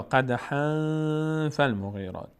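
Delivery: slow recited قدحاً with a held nasal hum; nasal ikhfa. Shown as a demonstration. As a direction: stretch the hidden n of قدحاً before فالمغیرات. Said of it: "این حکایت در این آیه".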